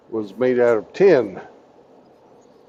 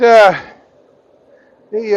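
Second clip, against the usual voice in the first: ordinary delivery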